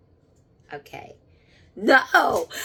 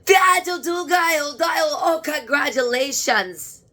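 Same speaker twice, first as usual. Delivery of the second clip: high, loud, overjoyed cries; joyful shout; 6 semitones higher, 6 dB louder than usual. Real, not acted. Medium greeting her father's spirit with joyful shouts. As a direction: blurt it out in joy.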